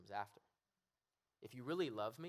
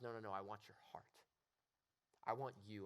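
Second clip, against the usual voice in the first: gentle God. Acted. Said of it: "And God says".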